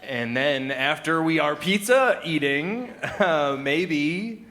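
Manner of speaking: hammed-up childlike proposition; German-speaking toddler. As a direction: piece it together haltingly like a toddler learner. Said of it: "a German-speaking toddler".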